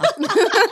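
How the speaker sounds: high burst of laughter; laughing. Real, not acted. Host teasing a guest about speaking up, high laugh. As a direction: laugh out loud, high-pitched.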